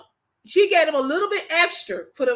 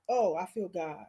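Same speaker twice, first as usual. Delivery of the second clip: moved murmur; 9 semitones lower, 11 dB quieter than usual. Real, not acted. Emotional aside while talking about hunger.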